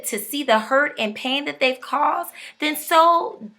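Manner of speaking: ordinary talk, nothing marked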